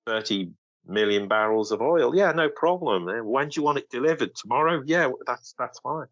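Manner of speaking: hammed-up accommodating supplier voice; mock eager seller. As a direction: play an oil seller cheerfully saying yes to anything. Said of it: "like us to appear and go".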